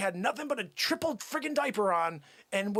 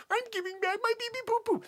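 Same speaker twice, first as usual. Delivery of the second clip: high-pitched, rushed mimicry; mock the customer in a squeaky high voice; 13 semitones higher, faster than usual. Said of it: "You go".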